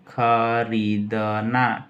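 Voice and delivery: खरीदना very slow as "kha-ree-da-naa"; slow dictation. Shown as a demonstration. Teacher writing what buy means, slow syllabic dictation. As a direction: break खरीदना into long syllables.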